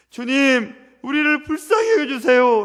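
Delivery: loud high cry for mercy; blind men crying out. Retold or imitated. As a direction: call out over the crowd for mercy. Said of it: "라고 외칩니다".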